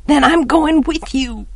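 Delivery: put-on high, choked-up child's voice. A narrator's reading. Child insisting on going with Jem, choked. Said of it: "I choked".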